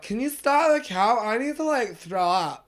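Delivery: put-on high drunken whine; drunk girl voice. Podcaster imitates drunk passenger in a high whine. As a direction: voice the sick passenger pleading. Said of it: "She's like".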